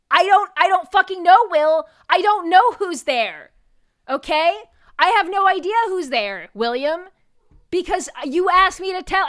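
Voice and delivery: loud, high-pitched rant at a prankster; exasperated outburst. Retold or imitated. Acting out exasperated reply to knock-knock joke.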